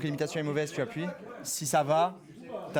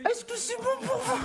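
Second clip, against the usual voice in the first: much higher, slow put-on voice; high drawn-out YouTuber impression; Squeezie impression. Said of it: "vas-y, Squeezie, hein".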